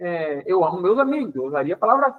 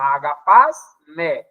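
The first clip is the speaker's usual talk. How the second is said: Agapasme drawn out very slowly, syllable by syllable; slow spelled-out word; 5 semitones lower, 4 dB louder, much slower than usual. Shown as a demonstration. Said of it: "Agapasme (spelled out letter by letter)".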